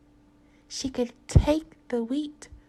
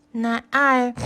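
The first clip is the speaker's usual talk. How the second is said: oinking pig voice; 8 dB louder than usual. Acted. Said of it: "oinked the rude pig".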